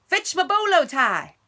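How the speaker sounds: loud, high yelled name; shout. Acted. Stepbrother yelling for Bubba, loud and high. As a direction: bellow his name across the ranch. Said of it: "shouted Dwayne".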